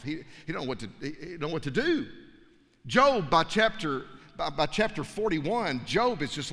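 normal talking voice

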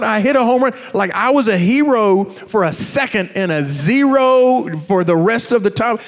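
ordinary talk

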